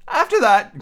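high, fretful Hatter; nervous Hatter voice. Acted. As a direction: plead it high and thin.

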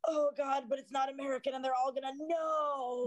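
high, stretched-out anxious wail; mock panic. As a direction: wail it in rising, hammed-up dread. Acts out imposter panic.

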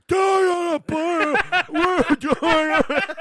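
high, loud wild Animal promo; wrestling promo. Retold or imitated. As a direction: yell it like an unhinged wrestler. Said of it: "Animal's like".

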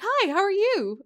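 high, chirpy imitation of a child's greeting; suddenly cheerful kid. Child greeting a neighbor right after crying, imitated high and chirpy. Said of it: "went from one end of the spectrum to the next".

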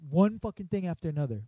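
imitating a fed-up woman storming out; mock angry rant.

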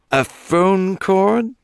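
gleeful British gossip, slowed and high; nasal British Clive. A narrator's reading. Clive relishing the murder news to Eli. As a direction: savour each word like a delicacy.

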